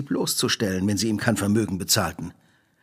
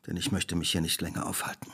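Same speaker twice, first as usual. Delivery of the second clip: whispered with quiet contempt; a whispered parting shot of disgust; cold whisper; 6 semitones lower, 10 dB quieter than usual. Acted. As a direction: breathe it out, disgusted, barely voiced. Holmes leaving in contempt.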